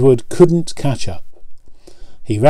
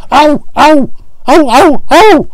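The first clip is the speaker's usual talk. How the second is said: high squealing cries of pain; screaming trucks; 14 semitones higher, 6 dB louder than usual. A narrator's reading. Trucks bumped by Edward, screaming in pain. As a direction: yelp each ow high and loud. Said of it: "screamed the trucks".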